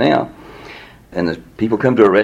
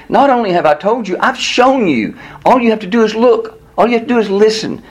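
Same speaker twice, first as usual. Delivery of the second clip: insistent appeal; 11 semitones higher than usual. Acted. Paraphrasing Jesus insisting to doubters.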